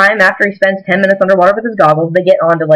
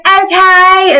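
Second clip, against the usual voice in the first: mock chirpy okay; 11 semitones higher, 4 dB louder than usual. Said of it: "she's like".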